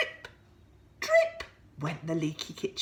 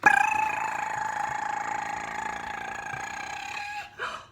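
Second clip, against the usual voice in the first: alarm; 3 dB louder than usual. Acted. Alarm clock ringing imitation.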